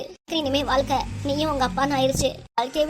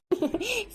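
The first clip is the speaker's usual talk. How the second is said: short, high-pitched chuckle; soft little laugh, pitched well up, pleased with the scheme; gloating chuckle; 7 semitones higher, 4 dB quieter than usual. Acted. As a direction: chuckle to yourself in triumph. Gloating alone after winning son over.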